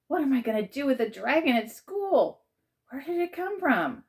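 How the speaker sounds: high, rising worried questions; panicked wondering. Acted. Boy wonders about the dragon, high and worried.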